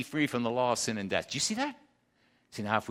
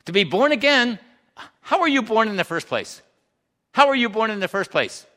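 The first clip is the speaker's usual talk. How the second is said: raised voice, driving the question home; loud preaching; 6 semitones higher, 7 dB louder than usual. Real, not acted. Preacher builds to shouted question.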